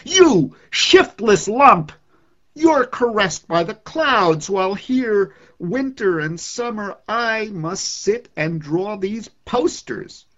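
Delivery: loud, high, slow furious scolding; angry yell. Acted. Poet scolding the sun for idling, loud angry yell. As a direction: berate the lazy sun at the top of your voice. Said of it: "I yelled".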